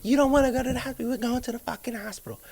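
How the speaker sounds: high, insistent mock girlfriend voice; bossy girlfriend. Retelling how Hillary insisted on the hospital, high mock voice. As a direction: play her as the one who won't take no.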